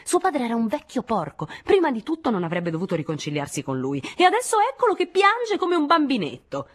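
high, heated contempt; shrill-rising indignant outburst; scornful tirade. A narrator's reading. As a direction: pour out scorn, pitch soaring at the end. Nadine keeps shouting her tirade.

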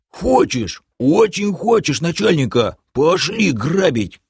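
high, bouncy character voice; gleeful, high-pitched eagerness; eager half-orc. A narrator's reading. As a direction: jump up keen to go raiding. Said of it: "бодро вскочил на ноги".

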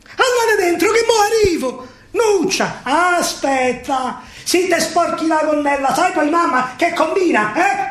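high, tearful calling to mother and father; Alfredo calling out. Acted. Alfredo calls to his parents and sister, high and tearful. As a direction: call to family who are not there.

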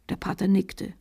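ordinary delivery

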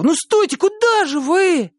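desperate shout. A narrator's reading. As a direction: shout after him in alarm. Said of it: "крикнул Валька". (отчаянный крик)

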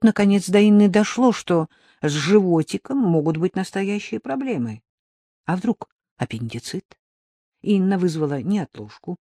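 plain reading voice

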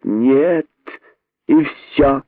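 put-on strict, final word to a child; stern refusal. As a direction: shut the idea down firmly. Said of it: "более строгим голосом".

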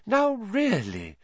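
high, slow mystic chiding; reproving Trelawney. A narrator's reading. Trelawney scolding the giggling table, slow and airy. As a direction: drawl a dreamy rebuke.

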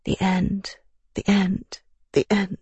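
high, soft, dazed repeating; numb repetition. A narrator's reading. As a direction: drift through it, lost and broken. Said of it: "stopped saying anything but".

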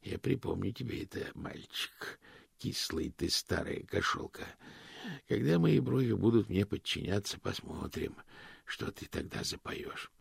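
hushed, half-whispered thought; inner thought. Acted. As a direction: mutter it under your breath. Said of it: "мысленно добавил".

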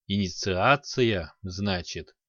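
put-on slow, rasping old man; hoarse old man. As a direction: croak it out slowly, hoarse. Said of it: "прохрипел старик".

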